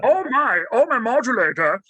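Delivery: fussy little alien fretting about his gadget; Marvin the Martian. Shown as a demonstration. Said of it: "You want Marvin the Martian?".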